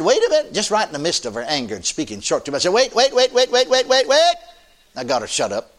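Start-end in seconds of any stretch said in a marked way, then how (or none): 0.0-0.5 sharp raised "wait a minute"; urgent call to stop. Retold or imitated. Preacher halting an angry church sister, raised voice. 2.7-4.3 high, loud rapid "wait, wait"; urgent repeated wait. Retold or imitated. Preacher hushing an angry sister, loud and high. Calling out to stop angry woman.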